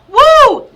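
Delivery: high excited whoop; loud whoop. Real, not acted. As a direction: let out a sudden excited woo. Sudden burst during reading.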